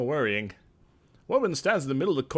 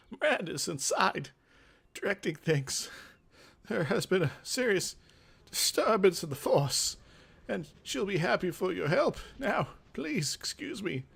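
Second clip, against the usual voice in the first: put-on higher, breathy-free old craftsman speaking in character; craftmaster's voice; 6 semitones higher than usual. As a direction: play the craftmaster, lofty and a bit condescending. Nicodemus voice again.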